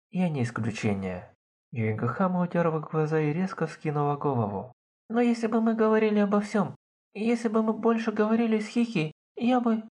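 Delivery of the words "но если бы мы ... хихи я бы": high, fast, rising to a cry; tearful outburst. A narrator's reading. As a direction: blurt it out fighting tears. Yuigahama bursting out, tearful. (высокий торопливый срыв в слёзы)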